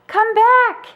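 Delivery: high, loud call; yelling. A narrator's reading. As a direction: shout after a dog running off. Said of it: "Linus yelled at Snoopy".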